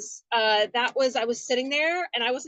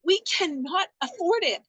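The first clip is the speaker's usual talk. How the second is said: pleading with husband; 8 semitones higher than usual. Retold or imitated. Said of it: "And I'm like".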